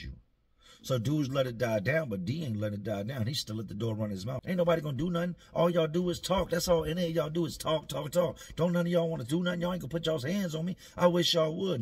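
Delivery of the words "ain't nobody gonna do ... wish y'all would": higher, louder taunt; mock taunting. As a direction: goad them all to try something. D taunting the pod.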